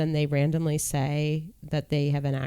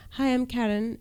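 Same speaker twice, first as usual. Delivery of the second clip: higher, softer mock British accent; heavy UK accent; 6 semitones higher, 4 dB quieter than usual. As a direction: imitate a British woman introducing herself. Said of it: "had such heavy accents".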